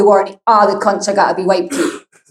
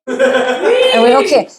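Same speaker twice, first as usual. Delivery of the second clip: laughing; 9 semitones higher, much slower than usual. Real, not acted. Checking in with the heckler.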